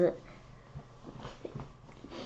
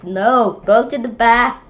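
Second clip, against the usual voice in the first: put-on loud "go to the back" from the kids; loud refusal; 2 semitones higher, 10 dB louder than usual. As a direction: order the cutter away. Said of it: "Everyone's like".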